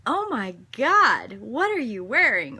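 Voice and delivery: mock child's loud outburst; child's loud blurt. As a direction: blurt it out like a tactless kid. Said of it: "the child said".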